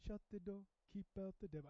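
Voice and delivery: softly imitated African accent; a touch of African accent, quietly quoted. Recalling a song he loves, soft African accent. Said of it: "say it like that with a good solid accent".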